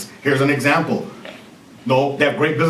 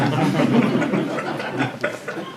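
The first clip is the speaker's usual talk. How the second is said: light laugh at his own point; a brief chuckle after the joke; short laugh; 4 dB quieter than usual. Real, not acted.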